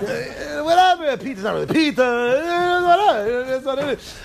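high-pitched, careless shrug of a voice; mock dismissive whine. Israeli hell defender brushing off complaints, high dismissive tone.

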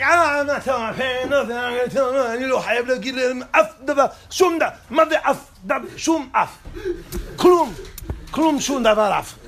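high, loud, laughing rant; excited shouting. Retold or imitated. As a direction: blurt it out defiantly, half laughing. Son refuses telling parents, shouting.

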